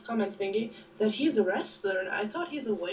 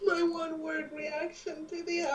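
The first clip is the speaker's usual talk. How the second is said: mock crying; 5 semitones higher than usual. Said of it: "don't cry".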